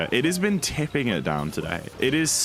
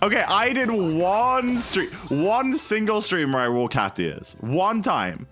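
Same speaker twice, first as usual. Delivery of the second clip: indignant protest; 8 semitones higher than usual. Real, not acted. Streamer protesting to chat she was never a cat girl, indignant and high. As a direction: protest the label, wound up. Indignantly denying catgirl arc.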